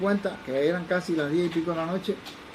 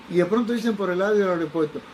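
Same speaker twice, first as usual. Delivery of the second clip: ordinary talk, nothing marked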